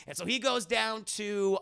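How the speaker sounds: ordinary talk, nothing marked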